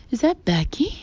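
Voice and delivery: high, loud shrill call; shrill neighbor. A narrator's reading. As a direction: pierce the air over the fence. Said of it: "A shrill voice pierces".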